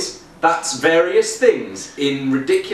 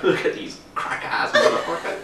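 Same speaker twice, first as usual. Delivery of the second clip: laughing mock viewer. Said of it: "they're just gonna be like".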